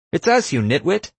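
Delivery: high-pitched, sharp yell; exasperated yell. A narrator's reading. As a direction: yell it at an ally who nearly shot you. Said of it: "Erica yelled".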